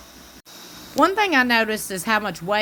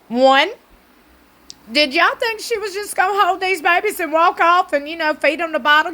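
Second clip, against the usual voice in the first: higher, louder incredulous rant; exasperated disbelief; 5 semitones higher, 3 dB louder than usual. Retold or imitated. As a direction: plead with the grown-ups, baffled. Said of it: "I'm like".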